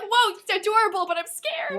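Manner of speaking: high, loud mock coo; half-joking frightened coo; mock scared cooing. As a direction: coo at a cute scary puppy. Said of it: "I'd be like".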